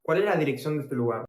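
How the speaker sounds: fast, run-together phrase in one breath; quick repeat. Shown as a demonstration. Teacher repeating how to ask for an address, quickly. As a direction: rattle the Spanish question off fast.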